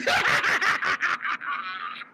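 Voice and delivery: high, soft cackle; gleeful cackle; high laugh. Real, not acted. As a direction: crack up high. Laughing at his trick.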